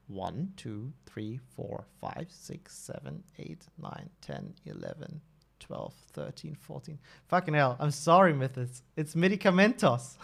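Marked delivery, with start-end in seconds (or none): 0.1-7.0 counting under the breath, much softer; quiet recount. Real, not acted. Streamer recounting posts for chat, hushed and careful. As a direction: mutter the numbers to yourself. Second recount after doubt.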